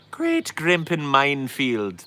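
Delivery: terrible stage-Irish rendition; bad Irish accent. Shown as a demonstration. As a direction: draw it out in a hammed-up Irish accent. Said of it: "That's Irish".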